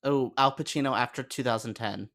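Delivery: ordinary talk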